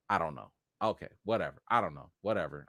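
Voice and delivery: 1 resigned. Real, not acted. Giving up on the rant.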